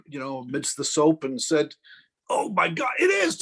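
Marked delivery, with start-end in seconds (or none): 2.3-3.4 amazed mock shout, rocketing upward; astonished exclamation. Doctor discovering the tattoo, astonished mock shout. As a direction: climb to a high cry of disbelief.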